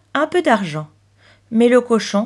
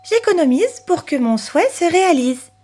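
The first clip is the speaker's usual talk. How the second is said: put-on higher-pitched, singsong child; lightly voiced little girl; 8 semitones higher than usual. Chloé receiving pocket money, reciting hopefully.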